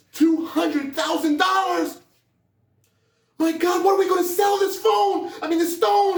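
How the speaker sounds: very high, loud, slow disbelieving shout; ecstatic shout. Acted. Son screaming the fortune at his father in disbelief. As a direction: scream it out amazed.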